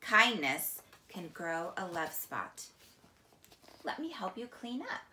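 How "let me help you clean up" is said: ordinary talk, nothing marked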